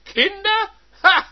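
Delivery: very high, loud scornful scoff; scornful wife. Acted. Wife scoffing at her husband, very high and loud. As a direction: laugh his claim off bitterly.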